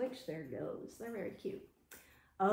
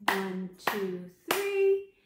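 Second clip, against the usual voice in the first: loud count; 3 semitones lower, 8 dB louder, much slower than usual. Acted. Teacher counting claps, loud final beat. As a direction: punch the last count.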